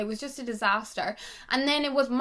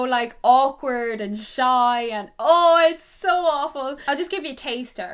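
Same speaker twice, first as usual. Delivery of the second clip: ordinary talk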